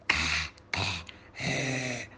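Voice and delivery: low, soft mock "ah" noises; mocking imitation. A narrator's reading. Classmate mimicking Joud's failed letter, mocking. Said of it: "يضحك أكثر ويقول".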